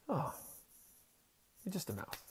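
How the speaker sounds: soft, gentle relief; relieved girl. Retold or imitated. Girl finally sees it is only a mouse, soft relieved coo. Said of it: "she looks at the mouse and goes".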